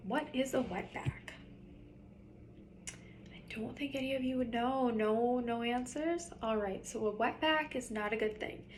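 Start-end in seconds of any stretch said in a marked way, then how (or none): 3.3-4.9 whispered aside. Real, not acted. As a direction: whisper it to the class, no voice at all. Teacher aside to the class.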